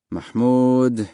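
drawn-out, disapproving name call; unhappy. A narrator's reading. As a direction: say it as a displeased father.

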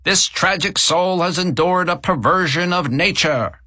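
high, brash barker call. A narrator's reading. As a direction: barker voice getting nearer. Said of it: "The voice grew louder".

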